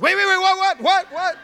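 very high, loud mock disbelief; stunned interruption. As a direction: cut in with rapid-fire shocked questions. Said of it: "he literally stops me".

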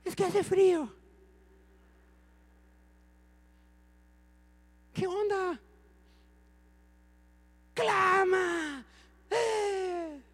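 voz aguda de fiel apático que pone excusas; imitando a un fiel desganado. Retold or imitated. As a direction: fingir pereza con voz aguda. Imita a la iglesia tibia. (un toque de feligrés desganado, voz más aguda)

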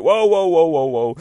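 loud, high whoas while being dragged; high, loud run of panicked whoas, easing off; alarmed whoas. Acted. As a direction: cry out as if yanked along by a dog. Girl yanked down the street.

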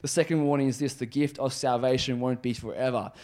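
normal talking voice

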